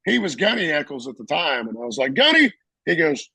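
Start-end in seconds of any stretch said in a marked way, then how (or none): 2.1-2.5 high, loud one-word blurt; mock startled reply. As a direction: blurt it like a flustered junior Marine. Startled junior addressing the gunny.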